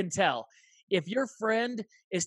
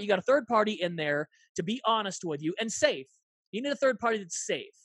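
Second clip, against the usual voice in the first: usual speaking voice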